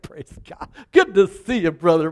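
overly sweet churchy greeting; mock-pious handshake at church; pious churchgoer. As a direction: lay on the holy charm.

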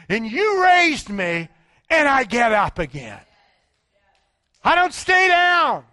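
loud, high, pounding sermon; fiery preaching. Acted. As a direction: preach it full force to the crowd. Preaching at full force.

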